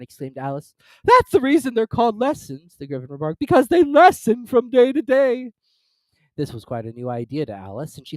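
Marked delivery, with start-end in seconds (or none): 1.0-5.5 loud, high gruff creature; hammed-up Gryphon; rough Gryphon voice. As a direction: boom it out like a rowdy beast.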